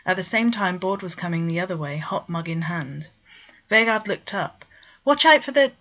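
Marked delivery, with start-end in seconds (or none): 5.1-5.7 sudden, higher and louder warning call; a shouted warning cut off short; urgent warning. Acted. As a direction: cry out before the collision. Warning as kitten runs at Bord.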